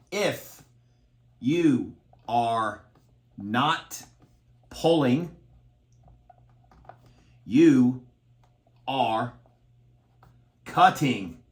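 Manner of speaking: word by word, a long pause between each; drill-instructor impression, every word set apart; Major Payne voice. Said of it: "In my major pain voice".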